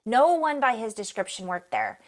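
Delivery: usual speaking voice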